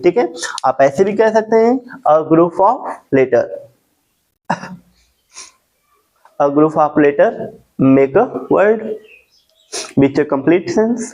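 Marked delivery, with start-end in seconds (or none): 2.1-11.0 lower, slow phrases with long pauses; slow dictation. Shown as a demonstration. Teacher offering students another definition, slow dictation. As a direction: dictate in short chunks, leaving time between.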